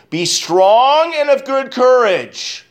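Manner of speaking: high, slow, ringing proclamation; slow and high, full of conviction; forceful proclamation. Acted. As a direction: lift the verse up like a rallying cry. Preacher reads verse with force.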